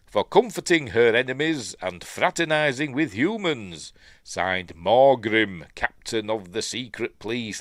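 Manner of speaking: loud, declaimed list of charges; pompous warrant reading; proclamation. Acted. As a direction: read it out like a formal decree.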